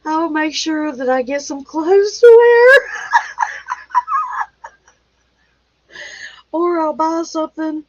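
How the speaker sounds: half-shouted through high laughter; trying to finish the line while cracking up; laughing. Real, not acted. Laughing at her own joke to viewers. Joking about buying clothes, laughing.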